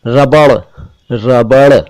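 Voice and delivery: रबड़ ending on a flapped ड़; correct flap. Shown as a demonstration. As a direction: finish रबड़ with a tongue flick. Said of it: "पहले र, फिर ब और फिर ड़".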